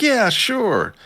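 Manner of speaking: mock reluctant yes. Voicing Ayano Koji agreeing to the girl, high mock-reluctant. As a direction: toss off a forced agreement up high.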